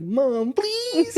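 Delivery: put-on high pleading kid voice; begging child voice. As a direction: whine it up like a little kid. Begging mom at Target.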